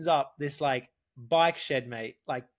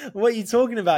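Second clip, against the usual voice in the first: high, loud disbelief; can't believe what he's hearing; surprised; 8 semitones higher, 5 dB louder, faster than usual. Retold or imitated. Incredulous retold reaction.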